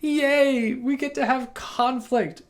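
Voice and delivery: high, bright sarcastic cheer over a conflict; mock cheerful. Mocking someone who loves conflict, in fake glee. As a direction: fake delight at a fight. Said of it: "you're like".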